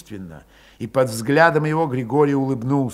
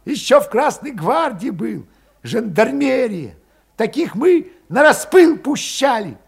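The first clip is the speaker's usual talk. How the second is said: high, mocking parting shot; stinging jab; 10 semitones higher, 6 dB louder than usual. A narrator's reading. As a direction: needle him on the way out. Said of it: "кольнул".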